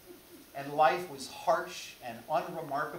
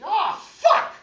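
loud pitched-up curse; meaty curse; 15 dB louder than usual. Pretend. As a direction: blurt out the curse in frustration. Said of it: "a good meaty".

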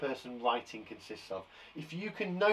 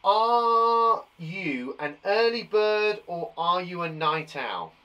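slow read-aloud question; 6 dB louder, much slower than usual. Shown as a demonstration. Teacher asking the class the quiz question slowly.

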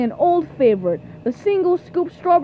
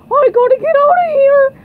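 high, loud, hurried panic; panicked cry; 10 semitones higher, 7 dB louder, faster than usual. Acted. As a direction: cry out in a rush to flee. Fleeing the announced fearsome creature.

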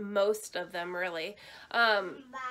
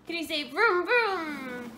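airplane spoon game; 7 semitones higher, 7 dB louder, slower than usual. Real, not acted. Teaching toddler car sound.

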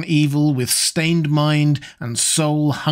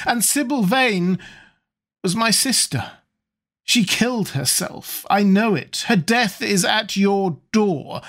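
high, emphatic grief-laden charge; avenging brother's pained reproach; anguished accusation; 6 semitones higher than usual. A narrator's reading. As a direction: accuse the killer of a sister, pitched up. Brother accuses over sister's death.

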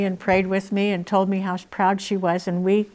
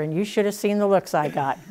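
ordinary talk

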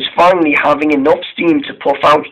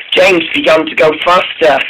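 ordinary delivery

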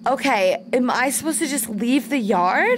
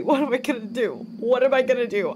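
ordinary delivery

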